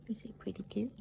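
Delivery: soft whispered pet talk; whispering. Real, not acted. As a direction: breathe it tenderly.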